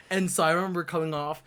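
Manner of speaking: ordinary talk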